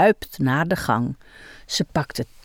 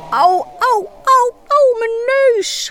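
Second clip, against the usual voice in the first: much higher, louder ow-ow cries; mock shrieking; 3 dB louder than usual. A narrator's reading. Michiel trips on the hose, high squealing. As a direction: squeal after tripping. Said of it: "gilt hij".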